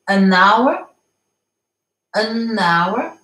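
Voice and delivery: "an hour" linked, the N running into "hour"; liaison. Shown as a demonstration. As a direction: join "an hour" as one word, silent H. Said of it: "la liaison sur le deuxième". (« an hour » avec la liaison)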